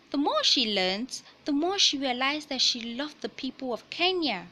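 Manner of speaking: picture book read-aloud voice; reading to children. Acted. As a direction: clear storybook narration.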